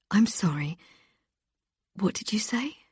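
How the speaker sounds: hushed, breathless shock; stunned disbelief. Acted. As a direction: barely get the question out. Presenter hears kidnapper confession.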